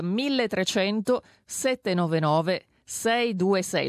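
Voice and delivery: slowly dictated phone number; slow, deliberate. Real, not acted. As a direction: give listeners time to note each digit. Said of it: "Lo dico lentamente perché ci hanno rimproverato". (numero dettato lentamente, cifra per cifra)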